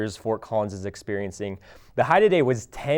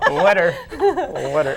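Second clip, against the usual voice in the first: laughing; 4 dB louder, slower than usual. Real, not acted. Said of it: "I can't talk 'cause of the cold".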